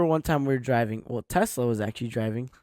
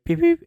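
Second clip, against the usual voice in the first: high, loud beep-beep; imitated beeping; 7 dB louder than usual. Passenger imitating the Tesla's alert, high and loud beeping. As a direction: pipe out two high mock beeps.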